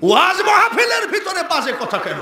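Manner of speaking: high, shouted question to the crowd; indignant challenge. Real, not acted. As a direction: throw it out at full force. Challenges crowd at full force. (উঁচু গলায় challenge ছুড়ে)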